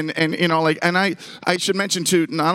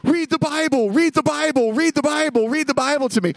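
put-on frantic kid's plea, pitched way up; retold screaming; 12 semitones higher than usual. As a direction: chant it urgently like a panicking child. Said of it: "and I'm screaming".

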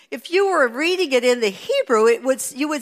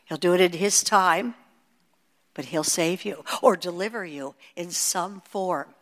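ordinary delivery

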